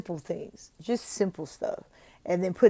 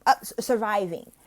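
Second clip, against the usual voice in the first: interrupting correction; 2 semitones higher than usual. Shown as a demonstration. The wrong way: tutor cuts off a struggling reader. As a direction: jump in over the reader. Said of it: "don't cut them off and be like".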